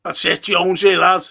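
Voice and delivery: loud praise shouted at the screen; loud cheering. Real, not acted. Fan cheering Jonesy on from home, loud. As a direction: call it out like he can hear you.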